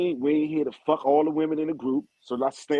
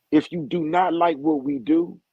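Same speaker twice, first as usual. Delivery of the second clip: ordinary delivery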